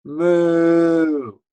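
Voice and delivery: high, loud moo; cow moo. Shown as a demonstration. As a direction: answer like another cow. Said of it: "another cow will go".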